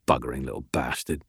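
low, quick mutter under the breath; dark mutter. A narrator's reading. Prisoner cursing the hungry investigator, dark low mutter. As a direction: curse softly to yourself, voice dropped. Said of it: "muttered darkly under his breath".

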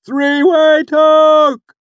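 a loud, high call from far down the line; distant shout. Acted. A troupe member shouting a warning down the line, loud and distant. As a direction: shout the warning back along the wagons. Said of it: "The shout came faintly".

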